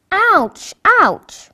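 sharp high ouch, then a faint whispered one; child waking with stomach pain, crying ouch. Acted. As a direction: wake up hurting and cry out. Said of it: "cried Sam as he woke".

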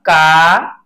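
का said slowly, the long aa stretched out; drawn-out का. Shown as a demonstration. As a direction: stretch का into a long clear kaa. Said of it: "इसका उच्चारण हो जाएगा". (का को खींचकर धीरे बोलिए)

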